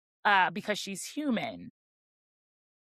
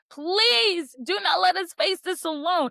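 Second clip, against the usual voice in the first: higher, pleading plea with a catch of despair; pleading breakdown; 9 semitones higher, 3 dB louder than usual. Retold or imitated. Nesta begging the queens for help, in a high pleading voice. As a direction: beg like someone at the end of her rope. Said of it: "Nesta breaks down".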